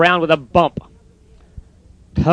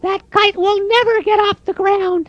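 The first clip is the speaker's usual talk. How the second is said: mocking robins. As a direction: tease him from the bush.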